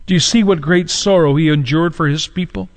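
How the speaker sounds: ordinary talk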